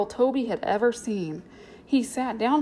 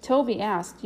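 usual speaking voice

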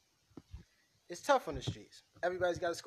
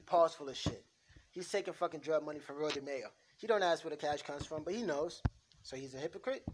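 ordinary talk, nothing marked

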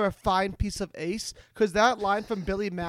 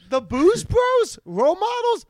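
mock incredulous; 10 semitones higher than usual. Real, not acted. Mocking the movie title.

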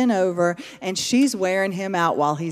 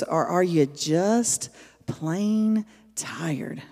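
whisper; 6 dB quieter, much slower than usual. Real, not acted. Preacher drops to a whisper.

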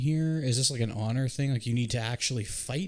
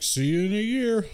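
much higher, louder mock knight voice; eerie knight; 9 semitones higher, 5 dB louder than usual. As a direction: taunt as a spooky giant. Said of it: "and he's like".

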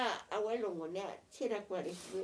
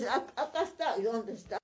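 mock loud quote; 4 semitones higher, 7 dB louder than usual. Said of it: "Luego tú decías".

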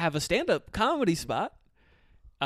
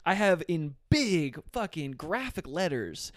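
normal talking voice

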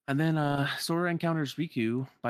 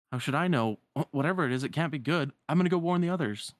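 urgent rival friend; 5 semitones higher, 5 dB louder than usual. Acted. Voices Riku reacting to the shaking.

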